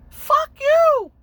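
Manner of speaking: put-on very high, loud drunken screech; drunk yell at cops. Rhoda screaming at the cops, high screech. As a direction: scream it at the cops like a wasted idiot.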